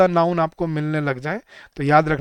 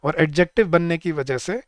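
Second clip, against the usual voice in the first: usual speaking voice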